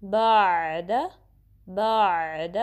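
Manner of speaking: बाद with a continuous mid-throat ayn; low, smooth बाद without a jerk; flowing ayn. Shown as a demonstration. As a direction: let the ayn flow on in बाद. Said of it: "और flow होगी".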